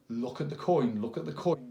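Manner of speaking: loud, commanding magician patter; loud patter demo. Shown as a demonstration. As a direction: call out to draw every eye. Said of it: "this is where the misdirection comes in".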